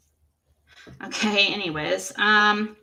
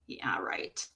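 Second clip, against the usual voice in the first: sarcastic whisper; 12 dB quieter than usual. Real, not acted. Scoffing at Don's apology.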